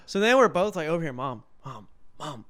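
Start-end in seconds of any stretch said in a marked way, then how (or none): 1.1-2.4 hushed calls to wake mom; trying to be quiet. Retold or imitated. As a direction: keep it soft, as if in a movie theater. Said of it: "we're trying to be quiet".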